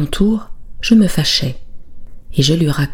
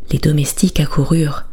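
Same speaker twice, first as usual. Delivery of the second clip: plain reading voice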